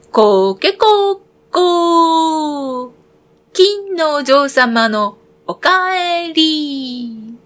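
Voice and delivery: high, slow drawn-out rooster call; sing-song cock-a-doodle cry, stretched very slow; rooster crow. Acted. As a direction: crow it like a proud rooster announcing her return. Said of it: "泣きました".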